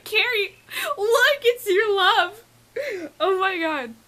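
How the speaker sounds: very high, drawn-out squeal to a friend; squealing, overwhelmed retelling; excited fangirl squeal. Retold or imitated. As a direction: relive it in a high, tearful squeal. Retelling excited call to her friend.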